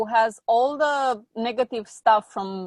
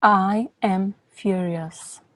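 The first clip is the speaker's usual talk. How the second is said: guided exercise; 2 semitones lower, slower than usual. Acted. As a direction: lead the client through it slowly. Guided anger-release exercise.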